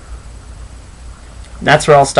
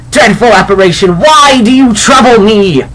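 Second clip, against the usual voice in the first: higher, louder, panicked pleading; frightened Scrooge; 8 semitones higher, 7 dB louder than usual. Acted. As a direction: cry out at the phantom in fear.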